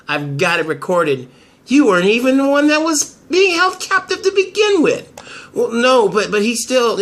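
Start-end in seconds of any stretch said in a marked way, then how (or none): 1.7-5.1 high voice, pointing out the lie; accusing woman. Acted. Lawyer accusing Cutter with a high, raised voice. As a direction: catch him out with a raised voice.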